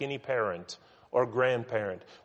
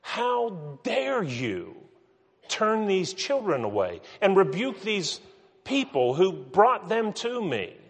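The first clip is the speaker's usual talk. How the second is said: indignant; 7 semitones higher than usual. Acted. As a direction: draw it out, incensed that they dared. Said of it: "He's indignant".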